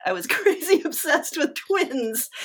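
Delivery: giggling at her own crazy phase; laughing. Real, not acted. Telling the interviewer about her twin obsession, laughing.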